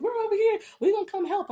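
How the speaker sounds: very high, loud goofy voice; silly friends. Retold or imitated. Friends offering help by phone, imitated in silly high voices. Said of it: "They're acting silly".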